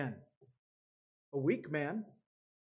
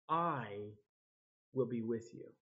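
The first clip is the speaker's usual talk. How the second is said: gentle God; 6 semitones lower, 6 dB quieter than usual. Acted. God promising Moses, soft and low. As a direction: reassure him quietly, word by word.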